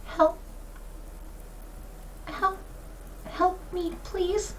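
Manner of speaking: faint, high little bird voice; a tiny bird pleading, high and soft; quail. Acted. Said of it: "and you just hear".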